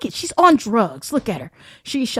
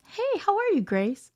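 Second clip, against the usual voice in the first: mock sweet greeting; 9 semitones higher, 6 dB quieter than usual. Shown as a demonstration. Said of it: "I'm gonna show you how I act".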